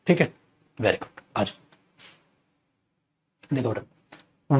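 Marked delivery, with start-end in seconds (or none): none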